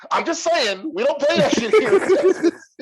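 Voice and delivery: high, loud hammed-up hillbilly outburst; hillbilly scolding. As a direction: pop in with a loud mock warning, breaking into laughter. Hillbilly pops head back in.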